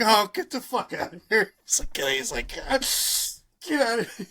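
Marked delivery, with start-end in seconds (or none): 0.0-1.5 high, stunned outburst of disbelief; shocked disbelief. Retold or imitated. Michael shocked on learning truth. 2.5-4.2 high, stunned and trailing off; disbelieving. Retold or imitated. Michael repeats his disbelief.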